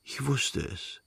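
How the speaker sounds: soft, slow, drowsy murmur; barely awake, savouring it; sleepy murmur. A narrator's reading. Sleepy boy murmurs to his returned mother, soft and slow.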